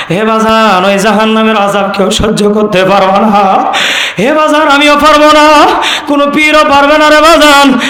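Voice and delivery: imitated preacher's sing-song lament; preacher impression. Shown as a demonstration. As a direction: plead and warn in a melodic waz chant. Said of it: "ওনার মতো কি হয়েছে নি?".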